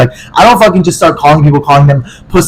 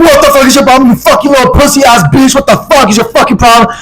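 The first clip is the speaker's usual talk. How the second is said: much higher-pitched mock rant; imitated angry screaming; 11 semitones higher than usual. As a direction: mimic a furious boyfriend screaming down the phone. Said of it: "Like, screaming at me".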